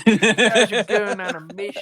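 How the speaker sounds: real laughter breaking into the words; laughing. Real, not acted. Laughing at the cracked-open beer joke.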